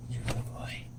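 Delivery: soft breathy whisper. Real, not acted. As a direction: whisper it to the dog. Whispering praise to the dog.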